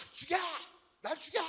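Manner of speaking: high, whiny "kill me" tantrum; mock yelling Jonah daring God to kill him; petulant mock yell. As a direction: throw a childish fit. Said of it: "막 개기고 있었어요".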